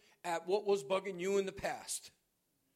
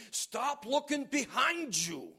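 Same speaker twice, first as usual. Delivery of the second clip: slow, higher, insistent command; 6 semitones higher, 5 dB louder than usual. Acted. Preacher voicing God to the church, slow and commanding. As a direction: stretch it out like a divine order.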